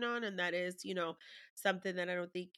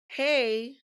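calling co-parent; 3 semitones higher, 9 dB louder than usual. Retold or imitated.

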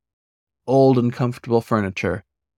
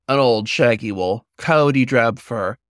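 plain reading voice

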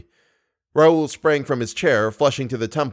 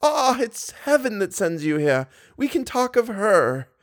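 put-on high, cheerful old lady voice; a sweet old woman, glad to see him; 10 semitones higher than usual. A narrator's reading. Old lady welcomes Raoul, high and cheerful.

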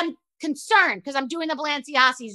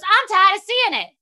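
high, louder mock rant; 7 semitones higher, 5 dB louder than usual. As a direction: mimic a fed-up star. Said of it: "That's exactly what she said".

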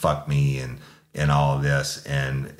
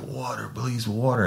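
begging; 8 semitones higher than usual. Retold or imitated. Suspect begging for water.

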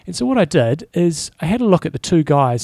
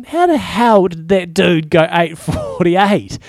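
higher, louder, slow incredulous thought; disbelieving; 4 semitones higher, 5 dB louder, slower than usual. Retold or imitated. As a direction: drag it out in mock disbelief. Disbelieving thought about rival's time.

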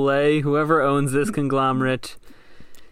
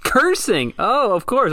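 mock surprise; 9 semitones higher, 3 dB louder, slower than usual. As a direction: pitch jumps way up in a sarcastic gasp of discovery. Guessing what the colonel regretted.

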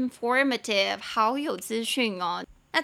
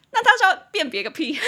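loud, high-pitched exasperated burst; mock outrage; 9 semitones higher, 8 dB louder, faster than usual. Host mocking face recognition behind mask and sunglasses, exasperated. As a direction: blurt it out, about to crack up.

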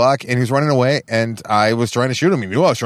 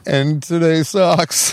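higher, drawn-out mock lament; mock weeping; 6 semitones higher, much slower than usual. Imagining himself pining for the past, mock weeping. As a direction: pretend to weep over it, very slowly. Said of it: "like, weeping openly".

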